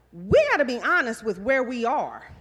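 usual speaking voice

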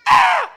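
sharp high eagle screech; eagle call. Pretend. Preacher voicing God calling his eagles, high eagle screech. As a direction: let out a piercing eagle cry. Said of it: "That's an eagle call".

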